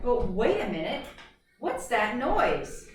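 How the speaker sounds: slow, building suspense; suspenseful reading. Acted. Teacher reading to the class, slow suspenseful storytelling. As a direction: draw it out like something is coming.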